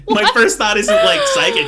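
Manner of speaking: high pitched laughing shout; laughing squeal. Real, not acted. Cracking up over the medium joke.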